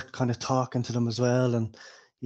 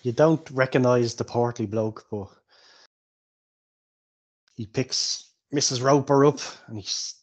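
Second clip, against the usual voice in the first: usual speaking voice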